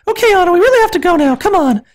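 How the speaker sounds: high and fast falsetto; hurried sister. Acted. Elsa hurrying Anna out the door, high and fast. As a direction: rush it in falsetto.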